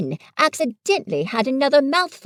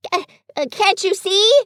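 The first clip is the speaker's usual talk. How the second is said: higher, louder frantic shout; 9 semitones higher, 4 dB louder than usual. A narrator's reading. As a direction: yell in exasperation. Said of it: "shouted Piglet".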